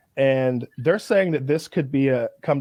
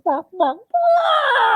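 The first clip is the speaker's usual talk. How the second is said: high yelping barks that shoot up; squeaky barking, rising to a shout; mock dino chicken barks; 4 dB louder, much slower than usual. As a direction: yap it out like a tiny silly creature. Mocking a dino chicken bark.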